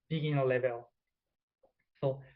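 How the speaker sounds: ordinary talk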